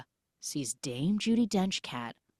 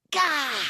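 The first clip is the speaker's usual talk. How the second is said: high, loud strained cry; mock all-out magical exertion; effortful burst; 11 semitones higher, 5 dB louder than usual. Magic cat uses all the strength given, loud strained burst.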